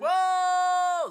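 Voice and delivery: real high, loud whoop; excited shout. Real, not acted. Player celebrating level sixty-one, loud high whoop. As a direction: shout a long cheer at full volume.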